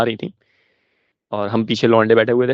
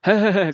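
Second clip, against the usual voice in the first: higher mock giggling "है, है, है"; imitated giggle; 7 semitones higher than usual. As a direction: giggle out "है, है, है" like two naughty boys. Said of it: "हम दोनों हंस रहे हैं". (दबी हुई नकली हँसी "है, है, है")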